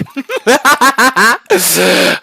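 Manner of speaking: loud, high maniacal laugh; insane cackle. Acted. Inmate giving in to madness, insane cackle. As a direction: burst into a crazed cackle. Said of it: "you become insane".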